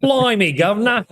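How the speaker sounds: high, hammed-up cockney accent; mock Cockney. Host answers his co-host in a jokey cockney accent. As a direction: play a cartoon London street voice.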